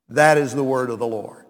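usual speaking voice

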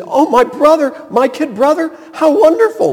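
high, loud sarcastic gushing; sarcastic mock joy at the brother's return; sarcastic gushing, pitched high and loud; mock delight. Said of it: "No. He was furious".

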